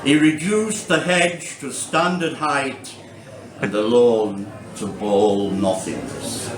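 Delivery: measured poem delivery with deliberate pauses; poetry reading. Acted. Poet reading a gnome poem to a Saturday-night audience, measured recital. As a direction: read verse aloud, lifting each new phrase and letting it fall. Reads own poem aloud.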